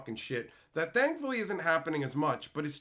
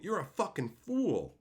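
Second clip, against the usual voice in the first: usual speaking voice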